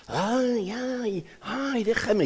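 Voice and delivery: high, soft whimpering "ay, ay, déjame"; pained teacup. Acted. Teacup in pain under potter's hands.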